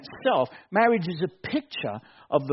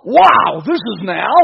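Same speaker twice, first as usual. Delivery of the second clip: amazed exclamation; 2 semitones higher, 12 dB louder than usual. Retold or imitated. Adam first seeing Eve, loud amazed exclamation. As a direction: gasp it out in awe.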